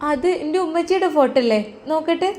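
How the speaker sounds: put-on a small girl worried for her dad; little girl. Daughter speaks.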